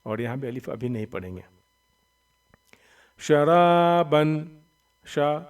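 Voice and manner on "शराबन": "शराबन" with loud, long-held रा; quick शा, stretched रा, quick बन in "शराबन". Shown as a demonstration. Teacher modelling madd, रा drawn out in "शराबन". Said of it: "रा, अलिफ ज़बर रा को तो खींचेंगे".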